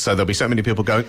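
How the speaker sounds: normal talking voice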